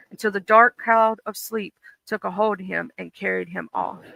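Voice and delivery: ordinary talk, nothing marked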